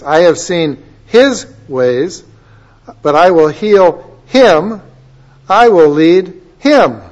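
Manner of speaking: slow, with "his" and "him" set apart and punched; pause, then lift "him" loud; stress on him, his. Shown as a demonstration. Preacher shows congregation the singular word, loud stress on "his" and "him". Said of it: "I want you to notice the word".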